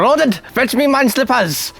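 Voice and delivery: high, lordly master giving orders; playful high posh accent; posh servant-master accent. Pretend. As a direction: put on a grand accent to command the pet. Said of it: "I'll have a different accent then".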